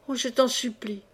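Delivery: ordinary delivery, nothing marked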